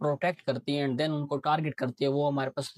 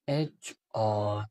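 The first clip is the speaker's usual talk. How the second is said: एचआरएल drawn out slowly, letter by letter; slow spelled-out HRL; 6 semitones lower, much slower than usual. Shown as a demonstration. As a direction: stretch एचआरएल slowly like a teacher dictating. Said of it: "इस तरह से mark करते". (एचआरएल को धीरे-धीरे, अक्षर-अक्षर खींचकर बोलें)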